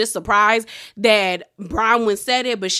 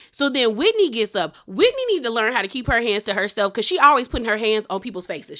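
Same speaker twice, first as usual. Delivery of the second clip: ordinary delivery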